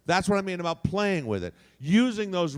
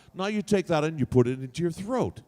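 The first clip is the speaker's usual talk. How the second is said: ordinary talk, nothing marked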